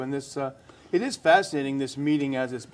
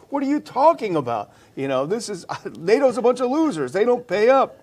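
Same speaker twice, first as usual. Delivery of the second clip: imitating a furious Trump, much higher and louder; furious Trump rant; 8 semitones higher, 4 dB louder than usual. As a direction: blow up like a frustrated president. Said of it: "he was getting frustrated and furious".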